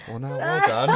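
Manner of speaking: very high, loud, laughing well done. Real, not acted. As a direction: crack up mid-line. Laughing at the slip.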